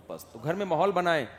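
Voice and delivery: ordinary talk, nothing marked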